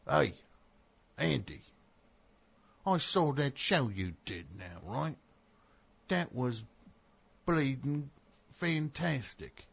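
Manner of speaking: put-on a scattered, hammy British accent; deliberately bad British accent, slow on bleeding fantastic. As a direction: ham up a British fan, badly. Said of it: "I'm all over the map with that accent".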